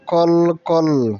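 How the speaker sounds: কল with the ল drawn out very slowly; held l. Shown as a demonstration. Teacher stretching the ল for the class. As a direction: hold the ল in কল long. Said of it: "লটিকে একটু বেশি সময় ধরে রাখবো".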